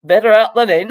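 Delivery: put-on Yorkshire accent, high and loud; mum's Yorkshire voice. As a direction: belt it out in Yorkshire like mum. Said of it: "as my mum would say in a Yorkshire voice".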